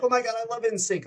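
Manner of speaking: high, gushing squeal-lite; a mild imitation of a thrilled star; excited fan. Country singer meeting the boy band, lightly imitated high gushing. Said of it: "she was like".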